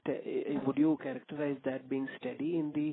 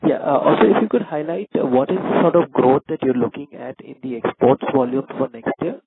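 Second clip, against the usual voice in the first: much louder voice on a call; speaking up; 13 dB louder than usual. Real, not acted. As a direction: project the question so it carries. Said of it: "Can you please speak a bit louder".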